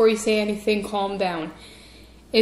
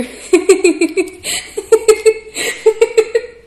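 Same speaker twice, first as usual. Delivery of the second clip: loud laugh; 10 semitones higher, 10 dB louder than usual. Real, not acted. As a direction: burst of high loud laughter. Laughing at own Wispa pun.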